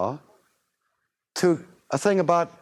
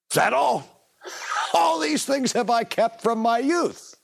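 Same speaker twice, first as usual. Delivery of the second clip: put-on higher, slower, smug voice of a young man; boastful young ruler; 8 semitones higher than usual. Preacher voicing the young ruler answering Jesus, smug and higher. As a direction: play the proud youth who thinks he's done enough.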